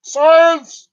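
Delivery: high, loud "Science!" cry; shouted exclamation. Pretend. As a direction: blurt it like a game show. Shouted announcement.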